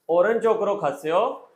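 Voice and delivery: normal talking voice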